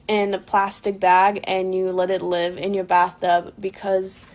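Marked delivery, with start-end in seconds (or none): none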